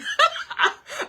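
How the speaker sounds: very high, loud breathy laugh; real high-pitched laugh of delight. Real, not acted. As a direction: burst out laughing at the surprise. Tea tastes like gingerbread cookie.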